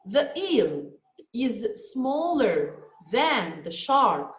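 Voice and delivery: slow and careful, pausing after "The eel"; teacher modelling a sentence slowly; slow model sentence. Shown as a demonstration. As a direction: read it out slowly for the class to repeat. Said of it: "Here we add E R to the adjective small".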